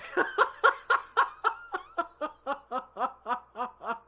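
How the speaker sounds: loud, high staccato cackling; long burst of helpless laughter; real laughing fit. Real, not acted. Cracking up at their Instagram page, loud laughing fit. Finds the pennies video hilarious.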